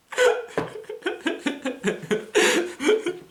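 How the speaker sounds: long high laugh after the punchline; real laugh at own joke. Real, not acted.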